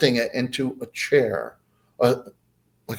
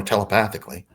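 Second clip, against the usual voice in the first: normal talking voice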